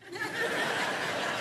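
quick airy giggle; high breathy laugh. Real, not acted. Preacher laughing at his own story, airy giggle.

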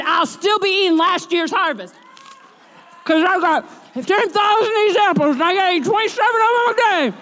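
high, loud, shouting preacher's build; preaching. Acted. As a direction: preach it out over the music. Preaching climax, congregation praise.

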